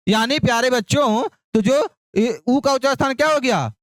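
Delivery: normal talking voice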